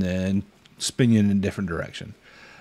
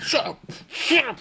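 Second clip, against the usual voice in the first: put-on tiny high-pitched baby voice; squeaky baby creature. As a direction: squeak it like a small alien child. Voicing Grogu.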